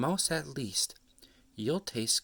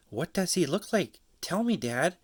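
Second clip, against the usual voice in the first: higher, eager little Gruffalo; curious child monster; 8 semitones higher, 4 dB louder than usual. Acted. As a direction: ask dad like an excited kid. Child asks her dad.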